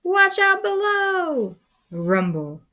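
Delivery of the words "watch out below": very high critter voice; high squeaky shout; squeaky warning. Acted. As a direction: warn those below in a squeak. Prairie dog warns others.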